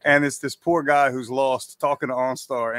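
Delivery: ordinary delivery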